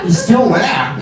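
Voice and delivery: halting, oddly paused Santa; a hint of Walken as Santa; Christopher Walken. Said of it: "bringing in Christopher Walken".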